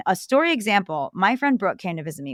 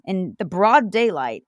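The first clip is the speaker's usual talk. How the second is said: usual speaking voice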